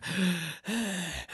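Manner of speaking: mock heavy mechanical breath; Darth Vader breathing. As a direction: rasp in and out like Vader's mask. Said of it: "his breathing is like".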